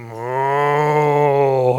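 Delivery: low drawn-out moo; 1 cow moo. A narrator's reading. Narrator imitates the cows in the field. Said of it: "he could hear them saying".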